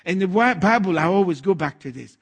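ordinary talk

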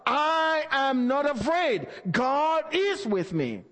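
slow declared shout of faith; demo yell. Shown as a demonstration. Pastor showing the church how to yell it out, slowly. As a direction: call it out slowly, as if alone and shouting. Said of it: "begin to yell".

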